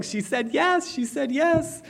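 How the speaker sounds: high, delighted squeal of good news; overjoyed. Retold or imitated. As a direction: burst out like a kid with great news. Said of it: "I'm like".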